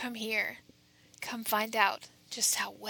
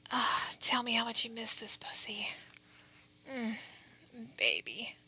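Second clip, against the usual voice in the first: close, breathy whisper right at the ear; whispering into your ear. Acted. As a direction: whisper it straight into their ear. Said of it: "whispering into your ear like this".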